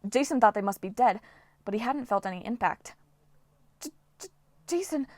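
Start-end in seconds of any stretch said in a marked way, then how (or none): 3.8-5.1 breathless, faltering stammer; stammering in shock. Acted. Piper after the fall, shocked stammer. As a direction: barely get the name out. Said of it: "Piper managed".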